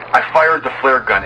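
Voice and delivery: usual speaking voice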